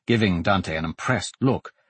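plain reading voice

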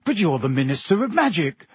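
ordinary delivery, nothing marked

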